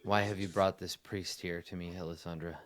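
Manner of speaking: soft, subdued in-character king; hushed royal question; weary king. Acted. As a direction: ask it quietly, as a troubled monarch.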